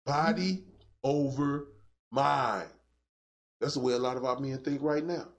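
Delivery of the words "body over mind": Body, over, mind, each word alone and falling lower; slow, stressed word by word. Shown as a demonstration. Preacher spells out the phrase.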